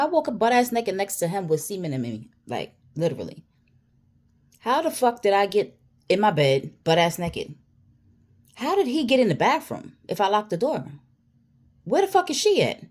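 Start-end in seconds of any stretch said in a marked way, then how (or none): 4.6-12.8 rising, louder, incredulous questions; baffled questioning. Retold or imitated. Woman relives her racing questions on waking, loud and incredulous. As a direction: fire off questions, climbing higher each time. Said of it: "mad questions just was like".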